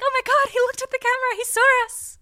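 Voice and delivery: high, loud, fast gasping excitement; excited shock. Retold or imitated. Viewer squealing that the priest saw the camera. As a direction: squeal that he's seen you. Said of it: "And you're like".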